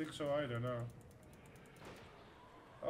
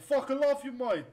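mock Australian shout; 7 semitones higher, 7 dB louder than usual. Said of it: "apologize for Australian people".

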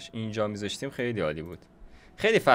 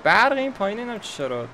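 higher and louder, annoyed surprise; exasperated surprise; 10 semitones higher, 5 dB louder than usual. Real, not acted. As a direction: react in real annoyed disbelief. Annoyed the power is out. (زیر و بلند، با تعجب و کلافگی واقعی از قطعی برق)

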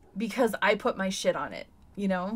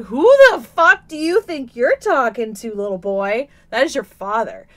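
stern mom; 9 dB louder than usual. Retold or imitated. Mom scolding son for disrespecting dad, loud. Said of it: "I was like".

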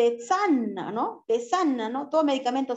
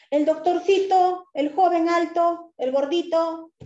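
high, louder, slow customer listing; customer asking; 6 semitones higher, 5 dB louder, slower than usual. Retold or imitated. Customers ask for the young male clerk, high and slow. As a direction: list him off sweetly and slow.

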